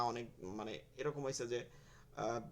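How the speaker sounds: normal talking voice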